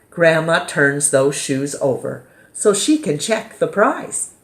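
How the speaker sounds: plain reading voice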